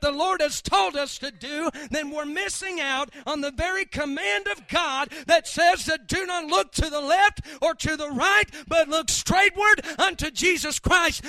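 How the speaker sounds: loud, fired-up preaching; preaching at full force. Real, not acted. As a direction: shout it from the pulpit.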